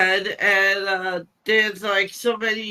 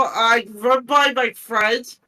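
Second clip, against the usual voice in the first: usual speaking voice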